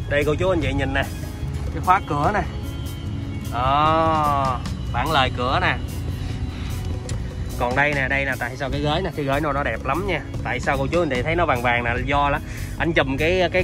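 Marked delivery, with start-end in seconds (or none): none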